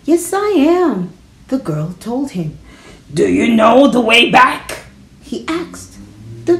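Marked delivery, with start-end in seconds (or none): 0.0-1.1 put-on high, slow child voice; little girl. Girl admitting to the wolf she is scared, high child voice. 3.1-4.9 higher, put-on wolf question. Wolf asking a lost girl the way, creature voice.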